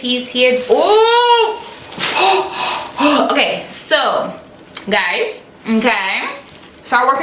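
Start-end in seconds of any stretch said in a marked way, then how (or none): 0.7-2.7 high thrilled squeal of delight; real gasp of joy; excited ooh. Real, not acted. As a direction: squeal at the outfit. Unboxing clothes haul excitement.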